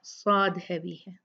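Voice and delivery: usual speaking voice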